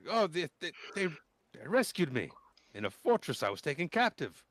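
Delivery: put-on high, halting young voice; lightly voiced shaken captive; hesitant reply. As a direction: stammer it out nervously. GM plays the other scout answering.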